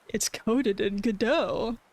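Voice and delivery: normal talking voice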